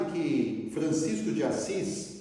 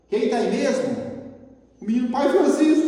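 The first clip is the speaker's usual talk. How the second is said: calling out; 10 semitones higher, 9 dB louder than usual. Acted. Monk and boy at door.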